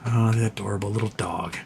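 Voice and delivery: hushed pet talk; soft coo. Real, not acted. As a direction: murmur fondly at the little dog. Cooing at the dog.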